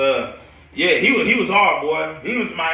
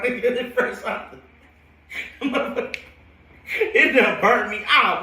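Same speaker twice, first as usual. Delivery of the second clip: real laughter, words stretched slowly through it; laughing; 7 semitones higher, much slower than usual. Real, not acted. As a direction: lose it laughing as the burn story lands. Laughing while telling coffee story.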